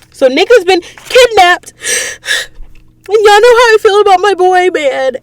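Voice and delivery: mock-anguished wail, high and loud; dramatic. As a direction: wail it like a devastated fan. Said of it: "I'm being dramatic".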